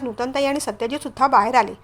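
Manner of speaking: ordinary delivery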